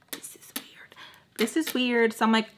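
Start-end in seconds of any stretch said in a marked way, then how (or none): 0.1-1.0 very soft whisper; whispered aside. Retold or imitated. Telling herself how weird it feels, whispered. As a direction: whisper it as if to yourself. Muttered aside, then said aloud.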